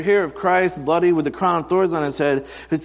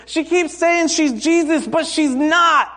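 put-on tattling child; 12 semitones higher, 5 dB louder than usual. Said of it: "she goes".

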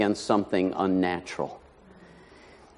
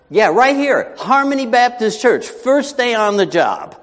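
high, raised-voice insistence; pitched way up, emphatic; emphatic exclamation; 11 semitones higher, 4 dB louder than usual. Acted. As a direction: drive it home, slowing on the last phrase. Mock answer to congregation's shocked reaction.

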